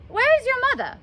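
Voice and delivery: sudden question. Acted. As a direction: blurt it out to dodge. Nurse abruptly changes subject.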